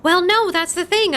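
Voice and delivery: usual speaking voice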